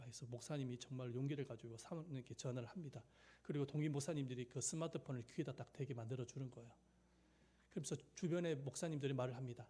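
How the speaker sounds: ordinary talk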